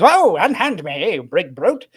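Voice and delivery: high, loud, slow protest; mock outrage at being grabbed; indignant old wizard. Wizard floating out of Barbo's grasp, high and indignant. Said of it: "And he goes".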